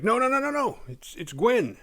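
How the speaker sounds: high, urgent string of no's; frantic stop. Retold or imitated. Jimmy stopping Kyle from shooting Gwen, high frantic no's. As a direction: yell to keep him from shooting her. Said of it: "Jimmy's like".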